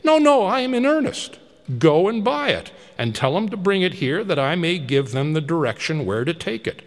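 high, loud, rushing offers; eager excited Scrooge. Acted. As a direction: hurry the boy off with promises. Scrooge urges the boy on.

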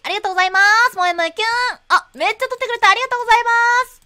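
high, loud maid chant; maid cafe voice. Pretend. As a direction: sing out moe moe kyun cutely. Maid-themed shop cashier.

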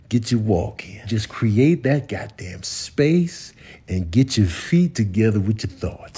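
usual speaking voice

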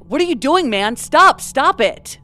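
urgent but restrained mock yell; desperate retold yell. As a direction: quote someone desperately yelling for it to stop. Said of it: "sound more desperate at this point, yelling".

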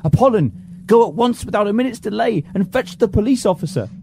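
high, urgent commanding shout; frantic order. Acted. Master ordering servant to fetch the police, frantic. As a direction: bark him out the door.

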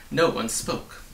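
plain reading voice

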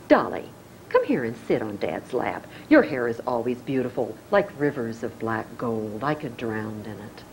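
low, doting fatherly tone; Charles voice. Acted. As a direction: croon it like a fond senator dad. Charles voiced in soap scene.

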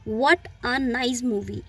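what a nice movie said very slowly, a reduced to uh; slow weak-form demo. Shown as a demonstration. Teacher slowly shows the unstressed a in what a nice movie. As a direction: spell out each word slowly. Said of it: "जब भी मैंने इसको flow में बोला".

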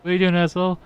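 high-pitched imitated indignation; mock-scold him as the annoyed guy; mock pissed-off. Streamer voicing the guy at whoever spoiled his throw, mock pissed-off. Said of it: "He's pissed. He's like".

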